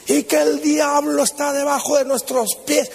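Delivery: acting out a preacher in full cry; fiery preacher. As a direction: preach it with mock power and fire. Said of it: "predicando así con poder y fuego".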